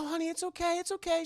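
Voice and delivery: high, soft there-there; tearful comfort. Retold or imitated. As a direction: coo it like a teary mom. Vera comforting through tears.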